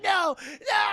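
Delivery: high, loud cry stretched into a wail; mock terror. Renfield facing Dracula on the stairs, mock terrified scream. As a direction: scream in hammed-up dread.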